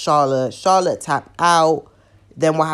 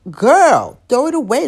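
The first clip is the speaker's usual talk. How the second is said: sassy dismissal; 9 semitones higher, 7 dB louder than usual. Real, not acted. Ranting vlogger dismisses the match.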